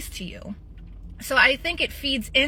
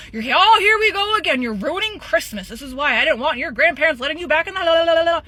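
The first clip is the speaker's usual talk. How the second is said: dad lashing out; 2 semitones higher, 6 dB louder, faster than usual. Retold or imitated. Father yelling at daughter on Christmas, mocking and furious. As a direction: sneer and shout the way her father did. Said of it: "lash out".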